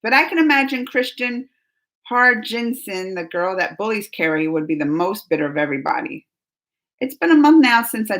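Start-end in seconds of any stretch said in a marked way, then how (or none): none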